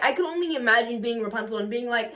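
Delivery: usual speaking voice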